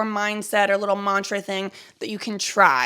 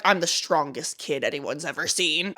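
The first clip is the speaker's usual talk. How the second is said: low, slow mock bravado; imitated childhood swagger; tough kid; 6 semitones lower, 5 dB quieter, slower than usual. Acted tough kid, against self-pity.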